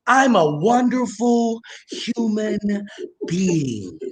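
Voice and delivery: slow, drawn-out grand declaration; imitating Varnell Hill. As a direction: stretch it like a pompous quote. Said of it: "In the words of Varnell Hill".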